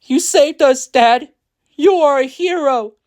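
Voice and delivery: put-on high, loud little-girl voice; Linda. A narrator's reading. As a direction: praise Dad in a high child's voice. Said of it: "said Linda".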